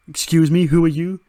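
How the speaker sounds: high, loud affronted question; indignant challenge. Acted. Andre Chase to the strange figure, loud high indignant challenge. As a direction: demand to know who this is.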